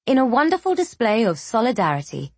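ordinary delivery

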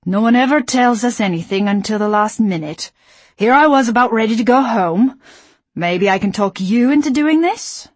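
loud, annoyed drawl. A narrator's reading. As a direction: drag the words out, annoyed. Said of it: "in an annoyed drawl".

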